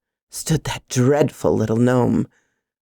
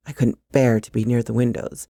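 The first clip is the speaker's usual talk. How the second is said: ordinary delivery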